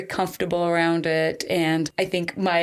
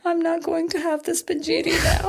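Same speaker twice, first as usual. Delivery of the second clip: mock sobbing whine; 10 semitones higher than usual. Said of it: "but I'm just bawling".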